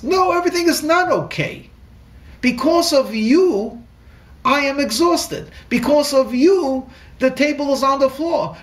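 retold shout. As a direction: hurl the blame at him. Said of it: "She shouts".

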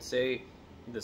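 talking while sniffing cigar; cigar held under nose. Real, not acted. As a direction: object touching upper lip. Seen 0: Sniffs cigar held against nose and upper lip while talking.

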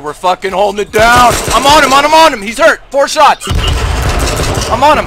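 loud, high hyped callouts; hyped shouting. Real, not acted. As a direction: yell the callouts, pumped up. Hyped callouts during a firefight.

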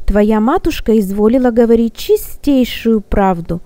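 ordinary delivery

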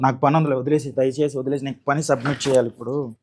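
tired husband murmurs please leave me be; pleading softly. Acted. Husband pleads to be left alone.

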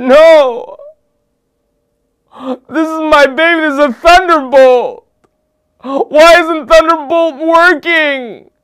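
high, loud, melodramatic despair; anguished wail. Retold or imitated. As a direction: wail on your knees like a heartbroken kid. Child on knees over broken car.

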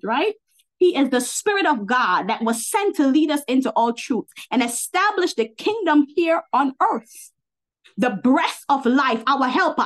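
emphatic preacher, stretching key words; fervent preaching. Real, not acted. Preacher declaring Holy Spirit is God, fervent. Preaches with rising force to listeners.

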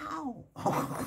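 high, soft "ow"; mock cry of pain. Examiner recalling school punishment, mock pained yelp. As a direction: imitate a small yelp, held back. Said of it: "the teacher would go".

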